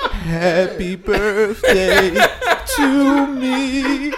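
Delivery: drawn-out mournful croon, laughing through it; slow, hammed-up lonely birthday wish; mock sad birthday song. Laughing friend croons a mock sad birthday wish. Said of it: "Listen at the table".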